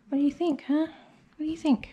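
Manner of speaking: high, cooing question to a dog; pet talk. Real, not acted. Groomer asking the fluffy dog in high pet talk.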